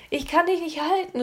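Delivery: high, loud cry of strain; panicked call. A narrator's reading. Girl losing her grip on Philipp, calling out in panic. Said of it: "rief sie".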